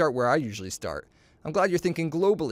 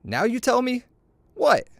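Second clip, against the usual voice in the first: indignant surprise; 10 semitones higher than usual. Acted. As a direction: cry out as if cheated by the news. Character outraged by the rival's shot.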